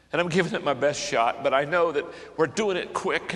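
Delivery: ordinary talk, nothing marked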